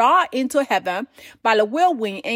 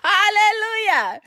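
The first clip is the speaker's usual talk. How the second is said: higher, laughing, then a slow joyful hallelujah; 9 semitones higher, slower than usual. Real, not acted. Preacher laughing at the punchline, then slow high hallelujah. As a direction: laugh it out and praise.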